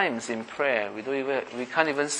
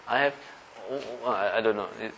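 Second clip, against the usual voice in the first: ordinary delivery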